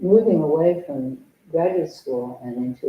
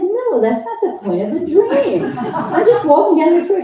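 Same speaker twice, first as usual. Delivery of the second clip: high, loud protest through laughter; exasperated, laughing it off; 14 semitones higher, 9 dB louder than usual. Retold or imitated. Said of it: "And I said".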